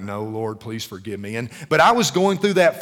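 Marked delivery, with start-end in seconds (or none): 0.0-1.4 meek apology. Retold or imitated. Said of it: "And you're like".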